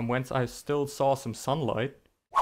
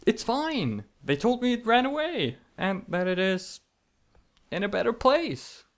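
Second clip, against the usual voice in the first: forced cheerful reassurance; 9 semitones higher than usual. Acted. Brushing off pet's fate.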